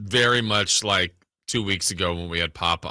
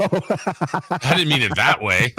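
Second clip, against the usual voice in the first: laughing protest; 6 semitones higher, much slower than usual. Real, not acted. Laughing defensive correction.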